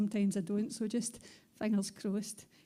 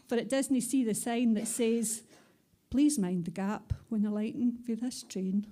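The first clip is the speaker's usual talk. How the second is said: rhythmic spoken-word recital in Scots; punchy, rhythmic poem delivery; poem performance; 5 dB louder than usual. Acted. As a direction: perform it like a live poetry slam.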